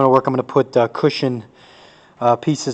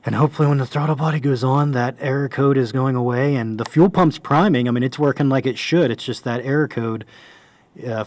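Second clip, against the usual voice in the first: normal talking voice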